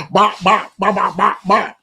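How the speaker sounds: loud punchy bop bop noises; punch sound effects. Retold or imitated. Showing how he'd have punched the bully, loud bops. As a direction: hit each bop like a jab.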